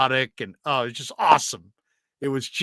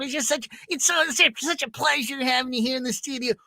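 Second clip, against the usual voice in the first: much higher, fawning Trent Baalke voice; Trent Baalke impression; 13 semitones higher than usual. Trent Baalke thanking Ralph for the interview, much higher gushing voice. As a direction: gush like a flattered GM. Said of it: "do Trent Baalke talking to the Razor".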